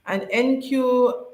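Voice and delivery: normal talking voice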